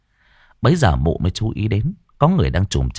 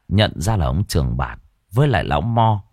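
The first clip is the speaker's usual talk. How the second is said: plain reading voice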